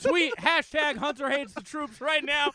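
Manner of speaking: rally the crowd in a put-on southern twang; mock southern accent. To the podcast crowd, mocking his friend in a loud southern accent. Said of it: "Why are you going southern?".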